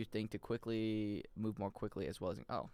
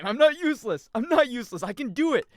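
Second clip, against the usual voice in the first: indignant shout; 11 semitones higher, 11 dB louder than usual. Pretend. Slime insulted, protests loudly.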